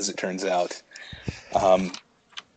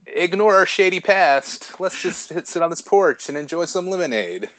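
put-on high-pitched genteel Southern accent; pitched-up, hammed-up Southern gentleman accent; Colonel Sanders; 8 semitones higher than usual. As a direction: play a charming old-South host. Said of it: "More like the Colonel".

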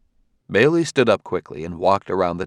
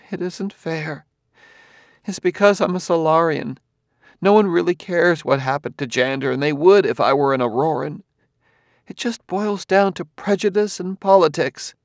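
high, aggrieved voice; Gladia, tearful; 9 semitones higher than usual. A narrator's reading. Gladia complains into Bailey's shirt after weeping, aggrieved.

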